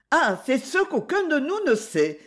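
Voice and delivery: high, airy light reply; lightly. A narrator's reading. Lady answering the wife lightly, airy and high. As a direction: toss it off lightly. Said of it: "avec légèreté".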